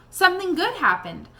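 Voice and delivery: ordinary delivery, nothing marked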